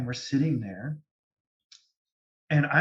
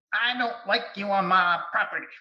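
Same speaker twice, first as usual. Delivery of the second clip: a hint of a stern, possessive old woman; angry ghost woman; 6 semitones higher than usual. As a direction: voice the ghost warning you off her land, higher. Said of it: "this is how she sounds".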